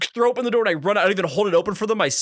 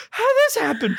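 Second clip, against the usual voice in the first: very high nervous squeak; fake innocence. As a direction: feign shock, pitch way up. Feigning ignorance to boss.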